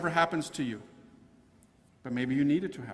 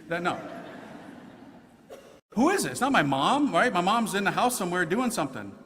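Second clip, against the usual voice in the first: normal talking voice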